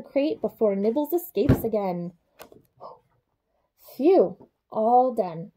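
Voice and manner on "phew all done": ordinary delivery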